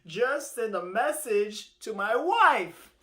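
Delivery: a hint of desperate begging; begging tortoise. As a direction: beg like a stranded tortoise. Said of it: "So Mister Tortoise begs".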